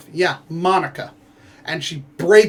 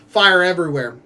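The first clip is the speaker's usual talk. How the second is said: ordinary delivery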